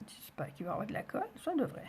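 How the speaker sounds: hushed, quick and half-whispered; muttering to herself. Real, not acted. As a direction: think aloud under your breath, hurriedly. Talks to herself while crafting. (marmonné pour soi, tout bas)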